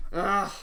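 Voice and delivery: exasperated ugh; disgusted groan. Real, not acted. Exasperated groan after rant.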